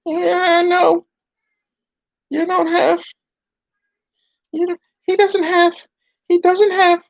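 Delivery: a rude dummy backpedaling; puppet voice. Acted. Puppet insults then backpedals.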